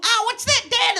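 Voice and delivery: put-on high squeaky child asking his dad; high kid voice, curious question; little kid voice. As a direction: pitch way up like a small curious kid.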